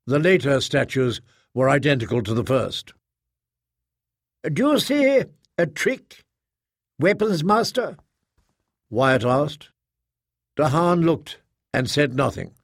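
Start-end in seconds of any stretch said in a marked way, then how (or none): 4.4-8.0 pointed challenge. Acted. Proctor challenges weapons master over the statues, high and pointed.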